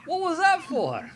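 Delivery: wife yelping high and loud after a smack; indignant. Acted. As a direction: cry out after being smacked. [name] hit on the head.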